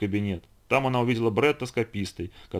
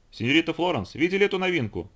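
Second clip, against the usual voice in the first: higher-pitched eager call; narrated call, raised in pitch more than volume; 7 semitones higher than usual. A narrator's reading. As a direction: call over to someone approaching. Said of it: "крикнул Эстебан".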